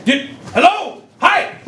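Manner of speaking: ordinary delivery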